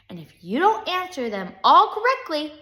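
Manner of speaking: high, loud teacher's threat; menacing classroom ultimatum; threatening. A narrator's reading. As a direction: raise pitch and volume to threaten. Teacher threatens pupil with brine.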